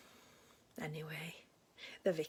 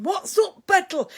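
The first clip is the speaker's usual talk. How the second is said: loud, high alarmed call; much louder and higher worried yell; mock shout; 12 semitones higher, 19 dB louder than usual. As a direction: shout it bursting into the room. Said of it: "rushed into the room shouting".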